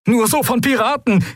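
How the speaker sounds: desperate captain warning of pirates; panicked cry. Acted. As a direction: shout the danger through static.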